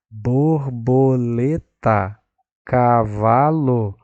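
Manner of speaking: Borboleta and cavalo spoken slowly, syllable by syllable; sounding out syllables. Shown as a demonstration. Teacher checking the syllables of a child's word, slow. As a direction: sound out bor-bo-le-ta, ca-va-lo. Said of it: "tem noção de sílaba?".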